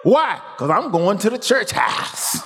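high, fervent preaching climb. Acted. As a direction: build the sermon to a peak. Preaching builds to a peak.

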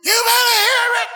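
high, loud shout; aggravated hollering. Retold or imitated. Front-row churchgoer shouting back at the preacher, aggravated. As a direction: yell it even harder, fed up. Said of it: "Got aggravated".

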